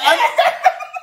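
very high, loud laugh; delighted laughter. Real, not acted. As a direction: break into a high cackle. Laughs at the retold story.

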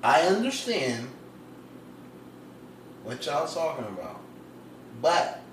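drawn-out delivery with long pauses; unhurried, halting spotlight speech; talking slow. Real, not acted. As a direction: take your time, pausing after each phrase. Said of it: "Why are you talking so slow?".

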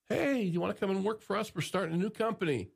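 high-voiced quoted job offer; recruiter's pitch. Voices recruiters in his story.

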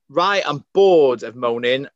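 loud, slow, firm call to the staff room; slow and loud, rally a moaning staff room; retold rallying call. Retelling how she rallied moaning colleagues, loud and slow. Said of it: "literally went".

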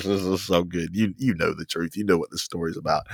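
hushed knowing aside; confiding. Acted. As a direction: share it like a secret. Drops to soft confiding.

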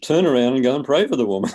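exasperated command. Retold or imitated. God ordering the hesitant evangelist to pray, exasperated command. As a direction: rattle off an acted, no-nonsense order.

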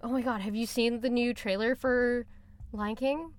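ordinary delivery

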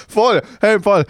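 ordinary talk, nothing marked

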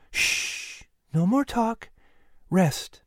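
soft whispered shush, then quiet words; gentle hushing. A narrator's reading. Mother quieting her son before sleep, softly. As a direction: hush him to sleep.

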